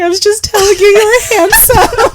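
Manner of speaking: very high wailing protest; mock crying complaint. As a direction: wail like a wronged crier. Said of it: "I was like".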